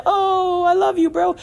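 high, gushing "Oh, I love you, bro" sent up as fake affection; mock touchy-feely gush. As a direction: play it as a sappy, overdone buddy hug in sound. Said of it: "not the touchy-feely".

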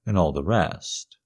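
ordinary delivery

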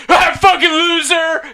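loud, high, drawn-out jeer; mocking shout. Friends jeering at a groom who cries, mocking shout. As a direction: heckle loudly, stretching out the words. Acted heckling of the crying groom.

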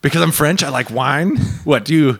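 hammed-up offended Frenchman accent; mock French accent. Friend mimicking the French man in the elevator, mock French accent. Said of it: "Should I do the racist accent?".

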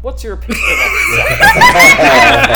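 high, loud, laughing shout. Real, not acted. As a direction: blurt it out laughing. Bold question shouted, laughing.

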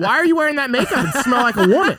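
high, loud, fast laughing wife; shrill accusing wife, half laughing; angry wife. Retold or imitated. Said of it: "She's gonna be like".

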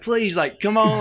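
high-pitched retold pleading; imitated wheedling plea; coaxing plea. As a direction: beg her to come back, half in jest. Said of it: "trying to reel her back in".

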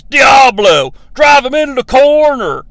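loud, hammed-up commander's order; shouted mock order; mock yell. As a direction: call it across a battlefield. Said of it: "Flag yells".

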